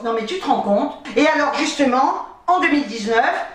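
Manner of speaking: normal talking voice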